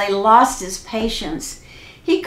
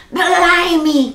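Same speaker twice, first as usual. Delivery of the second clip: 1 exclaimed pirate oath; 9 semitones higher, 5 dB louder than usual. A narrator's reading. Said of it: "cried Pete".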